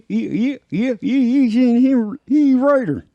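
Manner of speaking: put-on squeaky old-timer voice; Walter Brennan impression. Host switches back to the old actor, high old-timer. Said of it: "I would change to Walter".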